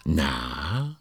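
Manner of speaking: ordinary talk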